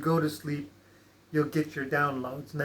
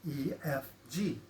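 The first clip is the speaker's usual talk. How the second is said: much softer, mouth closed, barely voiced; silent mouthing; 2 semitones lower, 9 dB quieter than usual. Shown as a demonstration. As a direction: say the letters silently to yourself with lips shut. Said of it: "say it silently with your mouth closed".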